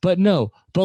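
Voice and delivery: ordinary talk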